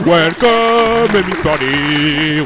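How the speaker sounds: high, drawn-out welcome; hammed-up welcome. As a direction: stretch it like a showman. Exaggerated welcome.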